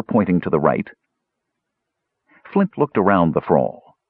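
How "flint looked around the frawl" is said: ordinary delivery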